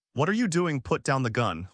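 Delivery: ordinary delivery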